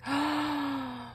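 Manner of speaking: long, high gasp; touched gasp. Real, not acted. Reading a kind note from a fan, a touched gasp. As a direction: draw a long surprised breath.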